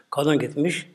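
ordinary delivery

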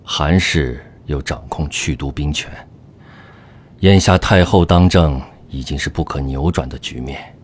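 sighing. Acted. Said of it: "叹声说".

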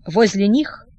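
ordinary delivery, nothing marked

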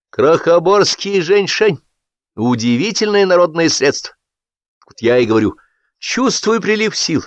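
high, sing-song gushing enthusiast; a fawning hack beaming at his comrades; high-pitched over-eager voice; gushing Sapfirov. A narrator's reading. Sapfirov boasting then complaining.

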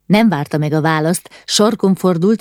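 ordinary delivery

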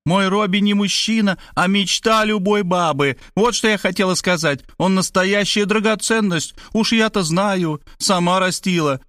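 high, gushing mother; impassioned high praise; inspired. A narrator's reading. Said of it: "Мать же вдохновенно продолжала".

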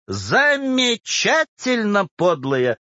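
high, drawn-out outrage, then dropping; indignant. A narrator's reading. Official fumes about the petitioner, indignant. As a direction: stretch the first word in indignant disbelief. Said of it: "возмущался Кистунов".

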